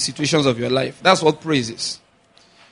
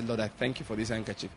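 very soft private prayer; under your breath; 4 semitones lower, 15 dB quieter than usual. Shown as a demonstration. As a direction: say it low in volume, as if only God hears. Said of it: "under your breath, say".

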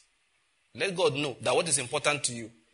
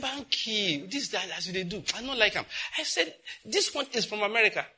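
high friend voice; 7 semitones higher than usual. Retold or imitated.

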